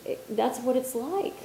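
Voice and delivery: normal talking voice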